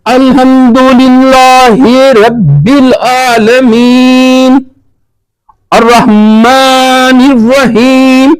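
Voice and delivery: very slow Quranic recitation with long drawn-out vowels; slow, measured recitation. Shown as a demonstration. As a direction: recite it unhurriedly, holding each syllable and pausing after each verse. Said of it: "تو ٹھہر ٹھہر کے پڑھیں". (ٹھہر ٹھہر کر تلاوت)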